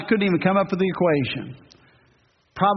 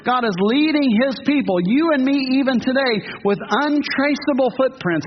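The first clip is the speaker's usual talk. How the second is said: preaching climax; 7 semitones higher than usual. Acted. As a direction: lift the congregation with each phrase.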